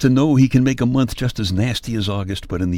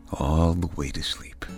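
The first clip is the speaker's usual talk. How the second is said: soothing bedtime whisper; 4 semitones lower, 5 dB quieter than usual. Acted. Bedtime lullaby close.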